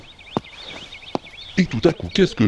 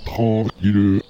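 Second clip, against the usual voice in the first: very slow; much slower than usual. Acted. Slow talk continues.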